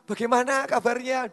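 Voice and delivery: high, loud, eager greeting re-enacted; hearty greeting. Pastor greets the man who keeps snubbing him, hearty greeting. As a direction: call out a loud, high hello.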